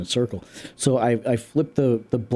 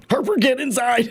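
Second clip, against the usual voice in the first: calling the dog; 10 semitones higher than usual. Retold or imitated. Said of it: "and I was like".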